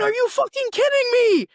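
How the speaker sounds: high, loud incredulous yell; outraged yell. Retold or imitated. Shouting at the spoiler friend, high and loud. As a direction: fling the question at them. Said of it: "I went".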